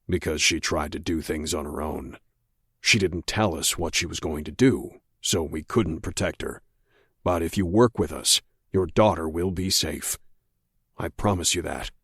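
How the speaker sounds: low steady agent voice. A narrator's reading. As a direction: speak low and measured, like a patient agent.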